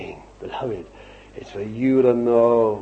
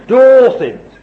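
loud, emphatic outburst; sudden shout; 11 semitones higher, 13 dB louder than usual. Real, not acted. Preacher breaking out on doing all things, sudden shout. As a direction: burst out with it at full voice. Sudden cry after laughing.

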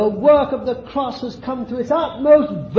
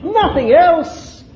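emphatic; 7 semitones higher, 5 dB louder, slower than usual. Shown as a demonstration. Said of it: "That's what it is".

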